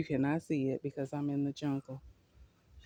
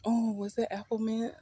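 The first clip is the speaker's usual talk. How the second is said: ordinary delivery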